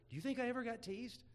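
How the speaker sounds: normal talking voice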